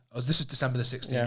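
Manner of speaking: usual speaking voice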